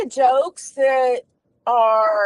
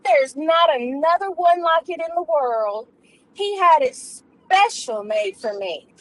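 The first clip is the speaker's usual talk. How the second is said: high, showy voice of a bragging lady; boastful lady friend; 5 semitones higher than usual. Acted. Lady at tea bragging about her ring to friends, high and showy. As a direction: brag sweetly to your tea friends.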